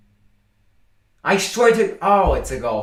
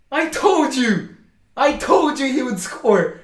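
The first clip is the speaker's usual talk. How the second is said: high, loud triumphant crowing; gloating celebration; 8 semitones higher, 3 dB louder than usual. Real, not acted. Fan gloating to his chat that he predicted the scorer, triumphant yelling. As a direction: rub it in, you called it.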